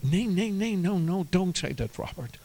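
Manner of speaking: high, soft protest, lightly imitated; mildly acted tearful refusal; imitating tearful mother. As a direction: protest softly like an upset mother, trailing down. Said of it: "she's crying and crying".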